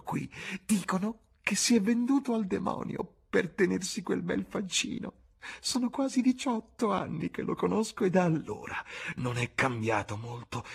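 put-on leering old woman. A narrator's reading. Bar woman telling James about Dorian, leering. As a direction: cackle out the gossip in a high crone voice. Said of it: "aggiunse con un ghigno laido".

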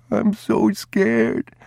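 put-on high, slow, tearful child's voice; a frightened boy clinging to his father; slow whimpered plea; crying, scared boy.

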